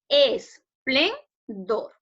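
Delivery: "Esplendor" very slowly split into es, plen, dor; syllable by syllable. Shown as a demonstration. Teacher divides the word for the class, "Esplendor" syllable by syllable. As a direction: say "Esplendor" in three drawn-out, separated syllables. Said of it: "lo vamos a dividir en sílabas".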